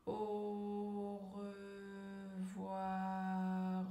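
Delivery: AU REVOIR very slow, each syllable held on a level tone; stretched syllables. Shown as a demonstration. Coach has learners repeat along, drawn-out AU REVOIR. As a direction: draw out AU, RE and VOIR evenly, almost chanted. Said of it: "allonger le mot, allonger la syllabe".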